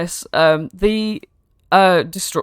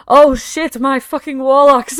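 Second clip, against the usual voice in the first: high, loud panicked outburst; 8 semitones higher, 7 dB louder, slower than usual. Acted. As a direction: blurt it as sudden panic hits. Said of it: "looks really panicked".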